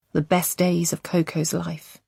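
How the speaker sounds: ordinary delivery, nothing marked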